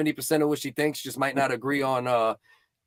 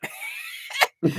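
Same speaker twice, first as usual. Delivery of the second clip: high breathy laugh. Real, not acted. Laughing outburst.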